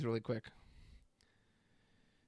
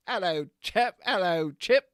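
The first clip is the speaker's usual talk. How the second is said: higher mock chap greeting; playful chap voice; 4 semitones higher, 4 dB louder than usual. Streamer greeting the two cartoon chipmunks, playful chap voice. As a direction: greet them as a jokey English chap.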